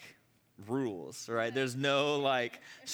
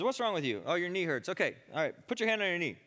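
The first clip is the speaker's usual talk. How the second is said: higher, businesslike instructor voice; brisk teacher; 6 semitones higher, 4 dB louder than usual. Retold or imitated. Vineyard teacher questioning the sick volunteer, brisk and raised. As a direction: take charge of the volunteer. Acting out remembered healing teacher.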